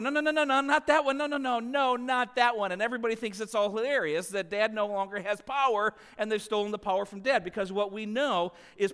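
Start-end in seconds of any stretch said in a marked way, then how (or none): 0.0-2.7 high, loud run of protests; hammed-up dad panic, easing slightly; frantic objection. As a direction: stop them urgently, pitched right up. Dad objecting again, retold.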